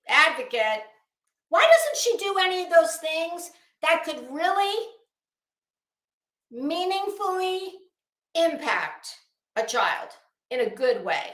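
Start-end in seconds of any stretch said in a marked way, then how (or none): none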